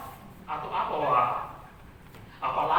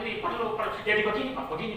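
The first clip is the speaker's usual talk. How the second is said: ordinary delivery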